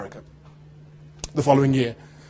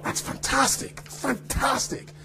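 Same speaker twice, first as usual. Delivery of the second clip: whispered, slow fantastic; whispered praise; 8 semitones higher, 11 dB quieter, much slower than usual. Retold or imitated. Friend watching the take, whispering praise. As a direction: breathe the praise without voice.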